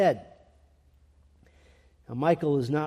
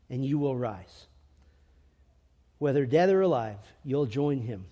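soft reassurance; 6 semitones lower, 3 dB quieter than usual. Real, not acted. Gentle promise after the shout.

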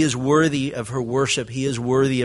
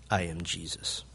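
much lower and softer, nearly under the breath; hushed repeat; 10 semitones lower, 12 dB quieter than usual. Retold or imitated. The preacher repeats God's answer to the congregation in a hush. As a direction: let it fall to a hush. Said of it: "God answered".

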